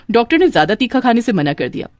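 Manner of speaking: ordinary delivery, nothing marked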